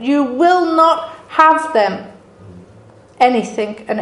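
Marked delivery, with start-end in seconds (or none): 0.0-2.1 raised, slow, fierce shouting at the birds; a grieving mother shouting down attackers; mock screaming. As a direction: drive the vultures off, full force. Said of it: "screaming and shouting".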